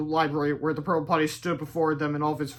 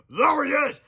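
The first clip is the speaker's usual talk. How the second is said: shout; 10 semitones higher, 4 dB louder, slower than usual. A narrator's reading. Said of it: "shouted a couple of".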